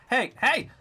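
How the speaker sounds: high, urgent "hey"; calling after someone. Acted. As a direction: call out to stop someone leaving. Calling after a man leaving.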